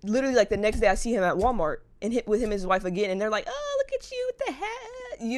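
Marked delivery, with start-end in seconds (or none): 3.5-5.2 high, slow, sing-song fake-friendly voice; sugary mock greeting. Imitating a couple at Walmart, syrupy sing-song greeting. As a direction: put on a syrupy sweet tone.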